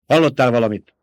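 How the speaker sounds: loud, curt snapped question. A narrator's reading. Baron pressing Peter for news, snapped. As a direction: snap the question out loudly. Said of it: "csattant rá a báró".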